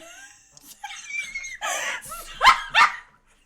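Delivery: loud high shrieking laugh; shocked shriek-laugh. Real, not acted. Streamer shocked by the deleted save, shrieking laugh. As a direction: let out a stunned shrieking laugh.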